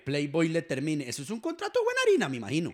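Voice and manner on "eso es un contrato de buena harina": squeaky high and fast, overblown; mock excited. Joking about Mia Khalifa's contract, squeaky high. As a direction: shoot it up high and rattle it off like a joke.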